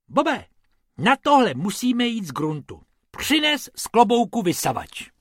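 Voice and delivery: high, firm instruction; rabbit giving orders. Acted. Bobek orders Bob to fetch the vacuum, high and firm.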